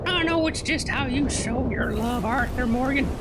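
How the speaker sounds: high-pitched put-on voice for a talking horse; high, slow horse character. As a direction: play the horse, pitched well up and drawn out. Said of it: "This is the voice I come with".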